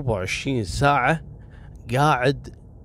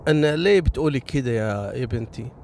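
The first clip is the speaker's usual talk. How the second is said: normal talking voice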